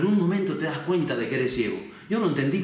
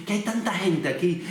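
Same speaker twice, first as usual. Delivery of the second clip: higher-pitched, voiced as a curious bystander's questions; higher, questioning, lightly acted; acted puzzled questioning; 5 semitones higher than usual. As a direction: ask as the blind man wondering at the crowd. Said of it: "imagino que preguntó".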